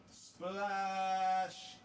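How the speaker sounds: high stretched splash; excited cry. Real, not acted. Streamer leading viewers in a high five, excited. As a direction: call it out long and high.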